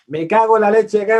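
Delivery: loud, high exasperated curse; mock angry outburst. A parent cursing loudly on seeing the child's grades. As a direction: blow up at the report card.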